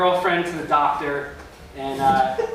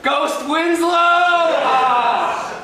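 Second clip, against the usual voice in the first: high, loud mock scream; terrified shout; 8 semitones higher, 5 dB louder, much slower than usual. Comic screaming at the ghost, loud mock shout. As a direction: shriek it at the ghost. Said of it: "And I was like".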